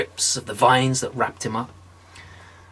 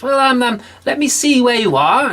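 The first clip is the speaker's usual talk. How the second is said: much higher, louder fussy clerk; fussy saint; 11 semitones higher, 5 dB louder than usual. Acted. Saint John checking his book, high and fussy. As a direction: bustle through the book.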